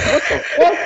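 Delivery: high squeaky laugh-through; laughing disbelief. Retold or imitated. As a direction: crack up in disbelief. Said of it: "I'm just like".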